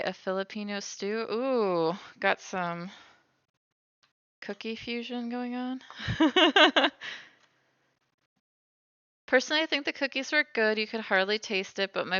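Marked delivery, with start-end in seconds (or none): 5.8-7.2 high squeaky laughter. Real, not acted. Laugh alone on line.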